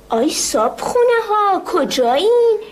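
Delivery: giant's shout. Acted. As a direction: bellow orders like a hungry giant. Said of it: "داد زد".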